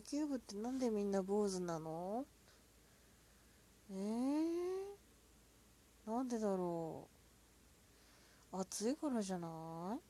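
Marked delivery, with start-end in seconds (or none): 3.9-6.1 surprised. Real, not acted. Puzzled by odd question.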